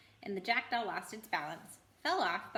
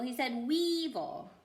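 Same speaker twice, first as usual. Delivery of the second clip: slow correction; 3 semitones higher, slower than usual. Acted. Animal correcting.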